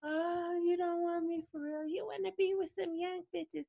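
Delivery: high, soft mock whine; put-on pleading little whine; whiny imitation. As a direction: whine like a needy, insecure girlfriend. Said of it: "somebody who don't whine like that".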